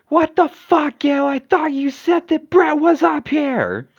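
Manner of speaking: high, loud outraged holler; indignant yelling. Acted. [name] finding Brett's room empty, yelling indignantly. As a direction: yell an accusation across the house. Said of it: "stop yelling!".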